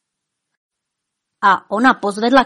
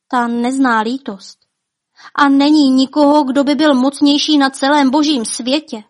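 proud boast; 5 semitones higher, 6 dB louder than usual. Acted. King boasting to his wife.

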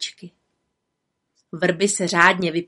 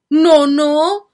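much higher, louder cry of protest; exclaiming; 8 semitones higher, 9 dB louder than usual. Acted. Little witch cries out at the woman's talk of dying. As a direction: call out to stop her. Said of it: "zvolala malá čarodějnice".

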